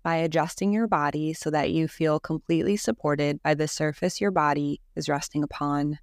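calm, even meditation voice with long pauses; guided meditation. Acted. Leads a Yoga Nidra meditation.

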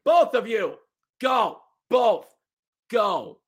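imitating a referee's order; stern ref. Referee sending both fighters to the box, stern order. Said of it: "the ref goes".

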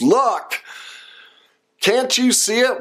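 pleading with a stubborn relative, half-mocking; exasperated plea. Imitating someone arguing with proof.

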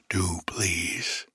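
low, soft plea; lightly voiced dog, hushed and imploring; pleading dog. A narrator's reading. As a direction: beg quietly, voice dropped. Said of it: "begging him not to send them away".

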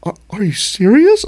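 high, stammering hurt disbelief; about to cry. Retold or imitated. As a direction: imitate a crushed man near tears. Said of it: "Like he was gonna cry".

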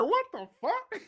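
very high, slow, laughing what the fuck; high laughing disbelief; mock stunned reaction. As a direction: squeak out the shock. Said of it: "First it's like".

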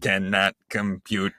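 low, slow robot voice; malfunctioning robot. Acted. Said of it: "say that in a robot voice".

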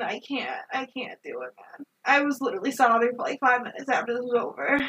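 ordinary talk

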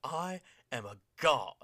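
slow, grandiose imitation; Bray Wyatt promo. Host mimics Bray Wyatt declaring himself, slow and grand. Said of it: "He says".

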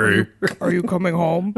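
lightly voiced creepy killer, laughing; a hint of a creepy killer; Buffalo Bill voice. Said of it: "Buffalo Bill voice where it's like".

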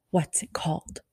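soft whisper of her question; a hushed, intimate ask; whispered quote. Retold or imitated. Idol asks the fan her podcast name in a whisper.

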